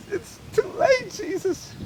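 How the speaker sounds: high, soft, crying voice; tearful despair. A narrator's reading. Father telling Jesus it's too late, tearful. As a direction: whimper it, broken with grief.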